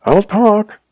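terrified pleading blubber. A narrator's reading. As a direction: beg for your life, blubbering. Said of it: "he blubbered".

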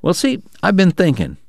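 a light hint of a sly schemer; Bob, musing. Bob to the poker players, lightly folksy musing. Bob's dialogue at poker table.

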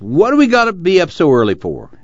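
high-pitched whiny grumble; grumbling. A narrator's reading. Al complains to Ma about rising early, grumbling. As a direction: grumble in protest at the early start. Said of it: "Al grumbled".

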